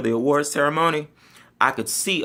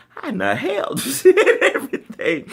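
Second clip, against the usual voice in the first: laughing disbelief; 3 dB louder than usual. Real, not acted.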